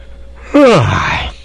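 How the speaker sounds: startling, loud open-mouthed yawn. A narrator's reading. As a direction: let out a big, sudden yawn. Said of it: "with a yawn that startled the insect".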